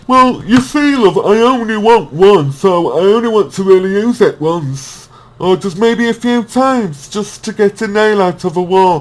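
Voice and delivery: mock posh customer voice, high and loud. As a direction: play the fussy customer asking for one sponge, pitched well up. Customer voiced in shop skit.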